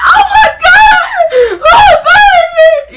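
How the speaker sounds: high, slow, loud wailing shout; imitating mom crying. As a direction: wail it like a frantic mom. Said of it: "she just crying".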